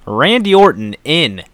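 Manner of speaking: slow, drawn-out wrestling announcer; ring announcer. Acted. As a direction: build up the main event like a ring announcer. Announces the main event.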